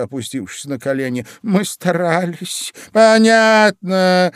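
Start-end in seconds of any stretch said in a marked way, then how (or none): 1.4-2.7 higher, slow, meek excuse; timid babble. A narrator's reading. Gardener on his knees excuses himself, mildly timid. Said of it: "пролепетал Двойка". 2.9-4.3 high, loud, drawn-out word; exclamation. A narrator's reading. Queen sees through the gardeners' excuse, loud exclamation. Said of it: "воскликнула королева".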